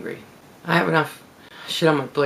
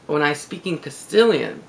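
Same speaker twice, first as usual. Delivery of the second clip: ordinary talk, nothing marked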